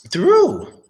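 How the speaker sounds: a sudden very high "Through"; playful high-pitched "Through"; high squeak. Shown as a demonstration. Playful high repeat of word.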